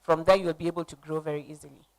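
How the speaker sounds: ordinary talk, nothing marked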